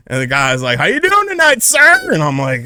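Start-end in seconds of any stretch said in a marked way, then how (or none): 0.8-2.1 high, mocking polite cop; officer greeting him at the car window. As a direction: play the officer high and sing-song. Cop voiced in story.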